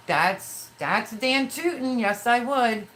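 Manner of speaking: "darn tootin'" thrown out high and proud; emphatic retort. Retold or imitated. Quoting her retort to ex-husband.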